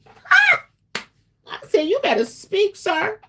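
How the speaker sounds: high-pitched squeal of approval; mock-squealed hype for a partner; playful hype. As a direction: cheer him on in a very high voice. Delighted reaction to boyfriend's saying.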